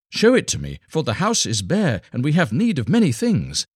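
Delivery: high, urgent wife's voice; eager wife. A narrator's reading. Wife begging her husband to reveal the bundle, urgent. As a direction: press him to show it, needy and hurried.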